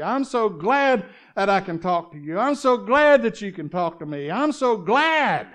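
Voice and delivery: loud, rising sermon fervor; preaching at full force. Acted. Preacher stirring the congregation, fervent and loud.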